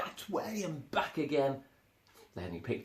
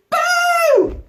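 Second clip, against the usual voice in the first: a huge, high, loud BOO; shout at the top of his voice; 16 dB louder than usual. Acted. Elmer bursts out to scare the serious elephants, huge loud shout. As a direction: blast it out to startle everyone. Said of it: "at the top of his voice shouted".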